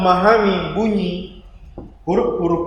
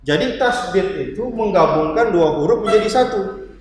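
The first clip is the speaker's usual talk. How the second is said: ordinary talk, nothing marked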